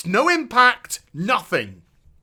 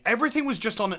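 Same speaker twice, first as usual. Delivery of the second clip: usual speaking voice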